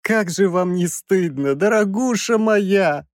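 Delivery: high, tearful reproach; whining professor. A narrator's reading. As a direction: whine it, dragging out the endearment. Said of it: "плаксиво осведомился Краевский".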